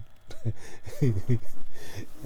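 real, breath-driven laugh. Real, not acted. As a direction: let a long laugh out. Host laughs after quick remark.

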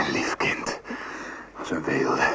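whispered pet name. Acted. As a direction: murmur it tenderly. Tender endearment.

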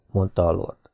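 ordinary delivery, nothing marked

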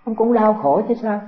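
ordinary talk, nothing marked

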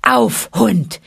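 a touch of a shout at the tiger; mild narrated shout. A narrator's reading. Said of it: "schrie Mowgli ihn an".

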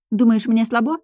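confrontational challenge. A narrator's reading. Girl challenging Korel, confrontational. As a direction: throw it out as a dare. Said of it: "наехала я".

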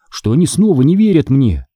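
plain reading voice

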